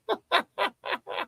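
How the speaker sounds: high, soft wheezing laugh; helpless high giggling. Real, not acted. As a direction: keep cracking up in little gasps. Still cracking up at chat.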